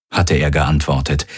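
ordinary delivery, nothing marked

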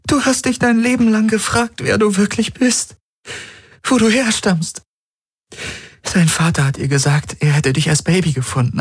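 high, rising, desperate plea; pleading young woman. Acted. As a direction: beg him to remember. Kirai keeps pleading.

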